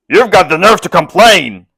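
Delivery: angry retort. A narrator's reading. Vassal retorts to captive.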